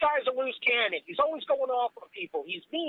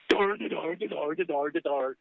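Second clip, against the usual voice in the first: mock dopey babble, sinking lower; mocking dimwit; 6 semitones lower, much slower than usual. Co-host ridiculing the sexism argument, mock-dopey. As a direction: imitate dumb chanting, dropping in pitch. Mocking the other side's logic.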